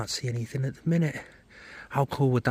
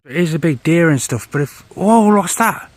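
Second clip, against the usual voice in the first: louder, startled and urgent; excited loud alarm; 5 semitones higher, 11 dB louder than usual. Real, not acted. Friend startled by animals watching them, loud alarmed call. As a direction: call out what you've spotted in the woods.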